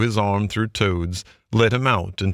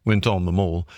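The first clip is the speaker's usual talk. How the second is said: ordinary delivery, nothing marked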